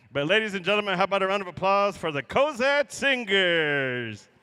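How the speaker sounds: loud arena announcer call; rally the crowd for applause; powwow emcee. Real, not acted.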